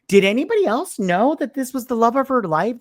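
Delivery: exasperated, high-pitched questioning; incredulous outburst. Acted. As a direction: demand an answer in high-pitched disbelief. Indignant reaction to the movie's plot.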